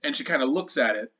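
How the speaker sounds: ordinary delivery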